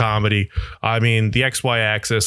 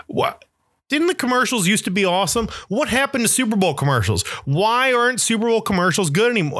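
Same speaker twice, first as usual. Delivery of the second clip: baffled disbelief; 8 semitones higher than usual. Real, not acted. Baffled about bad Super Bowl ads.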